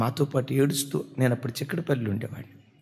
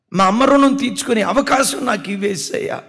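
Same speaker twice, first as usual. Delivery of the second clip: repeated plea, higher and louder, near sobbing; a son begging God through tears, raised voice; tearful plea; 8 semitones higher, 8 dB louder than usual. Retold or imitated. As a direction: cry it out the second time, pleading harder. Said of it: "ఏడుస్తూ చెప్పుతూ ఉండేవాడు".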